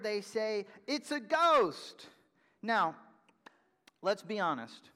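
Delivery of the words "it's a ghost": higher, louder and slow, acting the disciples' fright; scared cry. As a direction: cry it out like terrified fishermen. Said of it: "They are so scared".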